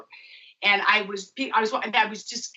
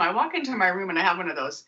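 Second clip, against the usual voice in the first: normal talking voice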